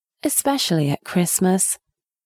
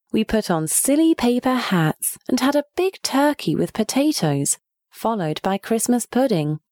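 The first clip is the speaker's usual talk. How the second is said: usual speaking voice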